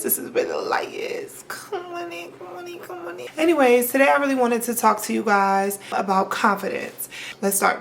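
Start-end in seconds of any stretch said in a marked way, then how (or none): 0.0-1.4 breathy whispered line. Pretend. Whispered intro phrase. 1.5-3.3 much softer, higher, coaxing; soft invitation. Pretend. Soft welcoming chant.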